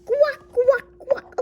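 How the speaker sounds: high, very loud quacks; duck quacking. Pretend. Duck pestering until told to stop, loud quacking. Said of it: "stop quacking".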